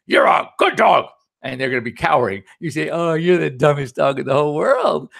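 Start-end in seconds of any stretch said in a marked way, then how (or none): 0.1-1.1 harsh tone. Shown as a demonstration. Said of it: "tone of our voice". 2.9-5.1 sweet tone. Shown as a demonstration. Owner coos an insult sweetly to a dog, gentle sing-song voice. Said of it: "tone of our voice".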